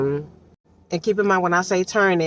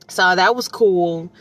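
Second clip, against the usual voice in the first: normal talking voice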